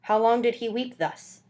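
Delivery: ordinary delivery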